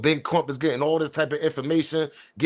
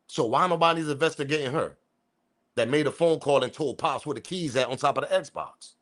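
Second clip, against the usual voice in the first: normal talking voice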